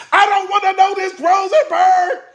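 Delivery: high, loud protest, slowing at the name; exasperated high cry. Retold or imitated. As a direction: beg him to stop telling you. Recalling telling Rosenberg.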